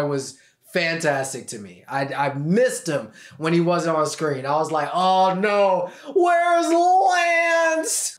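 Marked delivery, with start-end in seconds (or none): 4.9-8.2 high, hammed-up cry of alarm; mock-distressed, rising into a high cry; mock dismay. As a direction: wail it in exaggerated fan panic. Mock dismay at missing character.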